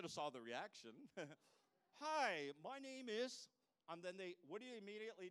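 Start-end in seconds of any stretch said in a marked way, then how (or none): 2.0-3.5 bright, higher, louder greeting; mock greeting. Greeting a stranger at a conference, cheerful. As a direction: greet them with cheerful surprise.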